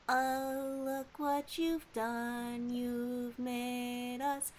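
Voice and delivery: slow, soft, drawn-out mock lament; hammed-up slow lament. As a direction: mourn the burger craving theatrically. Mock dramatic lament to chat.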